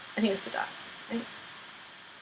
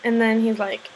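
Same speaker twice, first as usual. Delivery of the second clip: ordinary talk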